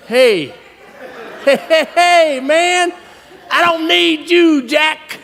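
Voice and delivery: high-pitched, stretched-out mock swagger; cocky gloating. Roper phones his friend back, cocky gloating. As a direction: rub it in with a rising brag.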